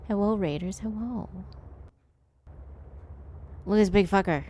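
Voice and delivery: usual speaking voice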